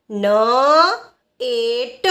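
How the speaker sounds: ન, એ, ટ held apart as single sounds; sounding out. Shown as a demonstration. Teacher revising net with learners, sounding out. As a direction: say ન, એ, ટ one by one. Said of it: "એનનું ઉચ્ચારણ થશે ન".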